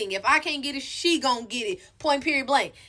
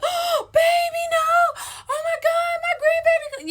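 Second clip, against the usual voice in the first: very high, slow shrieking mock mother; overreactive mom; 4 dB louder, slower than usual. Mother hears of the birth, overreacting in a high shriek. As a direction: ham up a dramatic grandma's wail. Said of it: "them overreactive parents".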